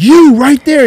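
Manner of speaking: high, loud call-out. Retold or imitated. Boyfriend in the bathroom, a mock loud call-out. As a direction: point him out with a loud yell.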